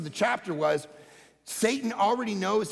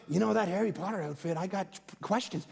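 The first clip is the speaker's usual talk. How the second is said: lower, softer, hurried aside; confiding friend; 4 semitones lower, 4 dB quieter than usual. Acted. Golf buddy questioning the pastor's costume, confiding. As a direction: imitate a friend quietly raising a concern. Friend sits him down to confront.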